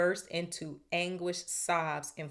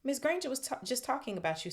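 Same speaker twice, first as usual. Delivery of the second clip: higher, sly tattling; 8 semitones higher, faster than usual. A narrator's reading. Peeves in Myrtle's ear, sly. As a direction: tell on her with mild slyness. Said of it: "said Peeves slyly".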